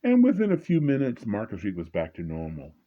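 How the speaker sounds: ordinary talk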